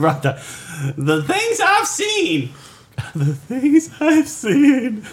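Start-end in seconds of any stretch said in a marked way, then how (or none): 1.0-2.5 dramatic high voice. Acted. Mock weary bra lament. 3.1-5.1 high, very slow, through laughter; drawn-out laughing falsetto. Acted. Repeated, slower and laughing.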